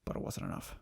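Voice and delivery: whispered, dark confession; grim whisper. Retold or imitated. Barry's voiceover admitting defeat, whispered. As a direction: breathe it out low and bleak. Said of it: "He's like".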